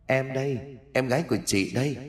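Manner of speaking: soft, hushed ghostly calling from behind; a chilling, quiet whisper-like lure; cold eerie ghost voice. Acted. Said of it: "Giọng nói lạnh lẽo ma mị".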